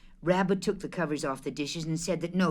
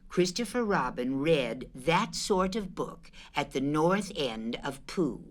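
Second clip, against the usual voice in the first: usual speaking voice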